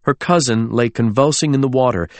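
ordinary delivery

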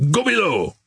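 high, angry order at ordinary volume; narrated shout. A narrator's reading. Officer angrily ordering Byrne below, restrained shout. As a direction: give a contained shout, pitched well up. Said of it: "he shouted".

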